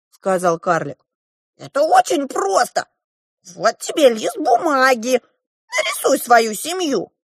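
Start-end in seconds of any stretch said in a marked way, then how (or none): 1.6-7.1 high, loud dwarf voice; bossy dwarf. A narrator's reading. The dwarf hands the girl paper, bossy. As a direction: instruct in a high, insistent pitch.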